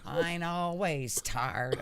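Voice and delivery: slow, drawn-out fake accent; Hillary's fake accent. Co-host mimics a politician on being tired, slow fake accent. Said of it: "That was the Hillary fake accent".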